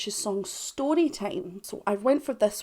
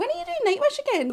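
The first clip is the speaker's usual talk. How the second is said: much higher, quick Scottish lady voice; high-pitched Scottish woman; faster than usual. Retold or imitated. Fans demanding more Nightwish, high Scottish woman voice. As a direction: mimic a shrill-sounding Scottish fan asking. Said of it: "they sound like a high-pitched Scottish woman".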